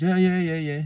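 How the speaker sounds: eager mock-girl voice, high and loud; retold nervous agreement. Voicing nervous girl answering Jim.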